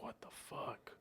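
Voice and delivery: barely audible, whispered reaction; stunned whisper. Retold or imitated. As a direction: whisper it in secret amazement. Retells secretly watching a late scene.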